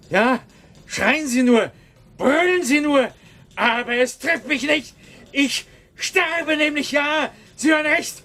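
building to a high, shouted cry; rising into a desperate scream; hysterical outburst. Acted. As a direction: clerk shrieks at his director that he is dying. Clerk confronts his director, breaking down.

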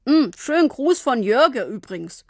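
mumbled round a biscuit; mumbling with mouth full. A narrator's reading. Father eating biscuits while greeting, mumbled. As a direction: talk through a mouthful of biscuits. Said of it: "Papa aß Kekse und nuschelte".

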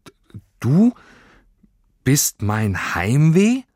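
halting, high, incredulous question; stammer, then draw it out rising in disbelief; confused. A narrator's reading. Girl realises the creature is her homesickness, confused. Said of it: "fragte sie verwirrt".

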